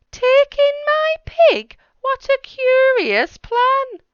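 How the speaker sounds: shocked at the odd plan; astonished old lady. Acted. Old lady reacting to the wise man, high astonished squeal.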